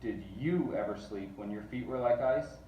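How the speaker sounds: ordinary delivery, nothing marked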